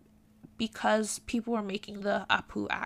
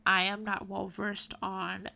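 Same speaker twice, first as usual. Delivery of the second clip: usual speaking voice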